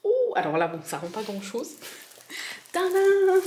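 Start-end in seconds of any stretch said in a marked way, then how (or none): 2.7-3.4 high, loud, drawn-out ta-da; big playful flourish, pitched way up; ta-da reveal. Real, not acted. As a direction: unveil it with a sing-out fanfare. Unveils the rolled canvas with fanfare.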